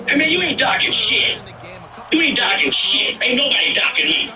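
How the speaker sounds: high, heated mock yell; retold angry outburst. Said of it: "they've never really heard me raise up like this".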